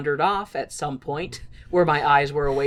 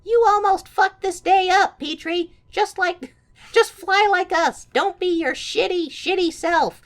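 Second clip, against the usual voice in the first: much higher, snotty voice; mocking siblings; 12 semitones higher, 5 dB louder than usual. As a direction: sneer at him like bratty siblings. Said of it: "his siblings are like".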